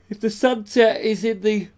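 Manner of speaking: words stretched through a big yawn. Real, not acted. Presenter yawning mid-sentence while describing the sunset photo. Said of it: "excuse me, yawning".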